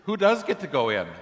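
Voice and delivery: fed up at being kept out of heaven, demanding answers; angry crowd at the pearly gates shouting up at Peter; frustrated outburst. Retold or imitated. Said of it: "they're so frustrated that finally they say".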